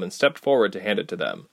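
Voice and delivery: ordinary delivery, nothing marked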